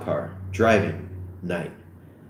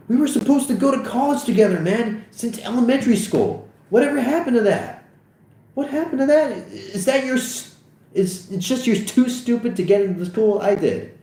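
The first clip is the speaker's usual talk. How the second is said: very high, upset rant; high-pitched, hurt protest; aggrieved outburst; 14 semitones higher than usual. Acted. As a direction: pour out the grievance, pitch way up. Acted quarrel, hurt friend rants.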